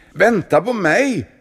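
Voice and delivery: high, loud call after a friend. A narrator's reading. Monk calling to his friend to wait. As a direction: shout up the stairs. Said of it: "ropade munken".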